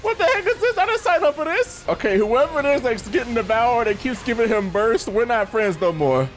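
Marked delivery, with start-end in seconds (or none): 0.0-1.8 high, half-laughing panic; alarmed high-pitched whine; freaked out. Real, not acted. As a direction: squeal it in disbelief. Panicked by heat-seeking boss.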